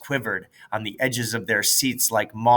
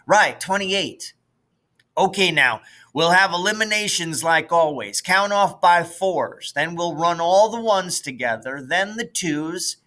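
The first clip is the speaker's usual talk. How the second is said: put-on bossy big kid; 6 semitones higher than usual. Fulcher organizing the race.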